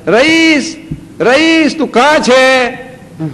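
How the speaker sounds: high, loud call across the house, slowing on the last words. Retold or imitated. Grandpa loudly calling for his grandson while pretending. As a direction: call a hiding child's name loudly. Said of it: "So I shout".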